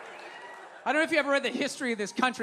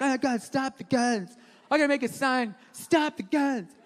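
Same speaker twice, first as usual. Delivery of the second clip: slow, drawn-out mock protest; mock whiny liberal. Comedian mimicking liberals against guns, mock distressed. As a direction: lightly caricature a fretting protester. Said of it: "But then liberals are like".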